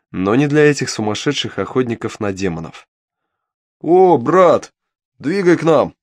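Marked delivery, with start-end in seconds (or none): 3.8-6.0 loud rowdy call across the tavern; shouting. Acted. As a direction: holler it high and loud. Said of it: "крикнул кто-то".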